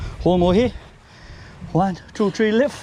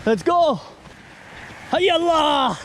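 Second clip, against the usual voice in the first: high rallying call to heave; rallying cry; 10 semitones higher, slower than usual. Real, not acted. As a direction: cheer the crew on. Cheering on the heave.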